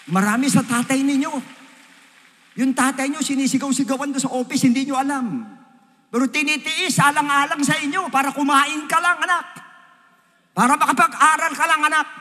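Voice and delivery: fervent preacher pleading, anak; preaching at full force. Acted. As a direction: cry it out to the young people. Climax of sermon appeal.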